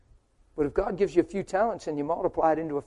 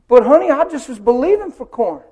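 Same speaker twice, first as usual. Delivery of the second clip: complaining husband; 8 semitones higher, 11 dB louder than usual. Acted. Husband whines.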